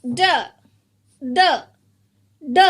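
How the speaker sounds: "D" as a short bare sound; isolated letter sound. Shown as a demonstration. Teacher has children touch shoulder, isolated "D" sound. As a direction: give only the opening "D" sound, cut short. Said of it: "Touch your shoulder".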